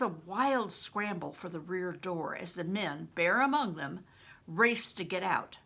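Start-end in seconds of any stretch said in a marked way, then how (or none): none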